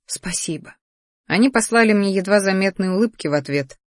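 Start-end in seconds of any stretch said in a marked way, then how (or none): 0.1-0.8 barely audible, hushed word; near-silent whispered aside; mouthed thanks. A narrator's reading. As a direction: breathe it out very softly, almost soundless. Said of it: "произнесла одними губами".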